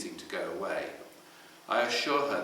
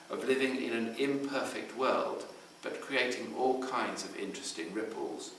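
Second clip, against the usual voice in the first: usual speaking voice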